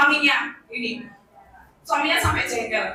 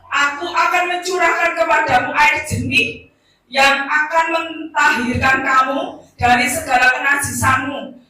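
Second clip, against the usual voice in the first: loud, proclaiming Bible reading; scripture reading; 7 dB louder than usual. Acted. Preacher reading Isaiah to the congregation, loud and proclaiming. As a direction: declaim the verses to the whole church.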